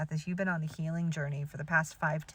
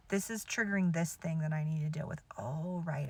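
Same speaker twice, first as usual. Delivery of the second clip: whispered inner thought; 4 semitones lower, 5 dB quieter than usual. Retold or imitated. Voicing an inner realization.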